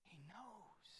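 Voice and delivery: very soft whisper; whispered closing refrain. Real, not acted. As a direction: barely breathe the words.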